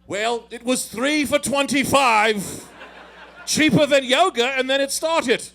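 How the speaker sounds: loud, hammed-up bad Irish accent; botched Irish brogue that slides towards pirate; terrible Irish accent. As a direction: do Conor McGregor in an awful Irish accent. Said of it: "the worst Irish accent you'll ever hear in your life".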